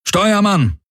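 high-pitched summons to the helmsman; commander's call. A narrator's reading. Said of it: "rief der Feldherr".

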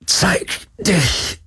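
high, very slow challenge; strained call. A narrator's reading. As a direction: call out word by word with great effort. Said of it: "rief er". (angestrengtes, langsames, hohes Rufen)

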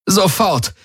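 high, urgent, trembling command; alarmed, high-pitched order; trembling call. A narrator's reading. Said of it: "rief sie mit zitternder Stimme".

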